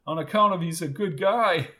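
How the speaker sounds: amused line breaking into a laugh. A narrator's reading. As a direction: joke ending in a chuckle.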